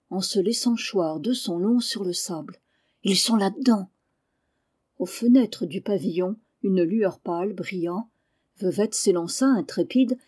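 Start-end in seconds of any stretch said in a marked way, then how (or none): 3.0-3.9 mild cry of alarm; frightened warning, lightly acted. A narrator's reading. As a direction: call out the danger in fright. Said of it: "pousser un grand cri".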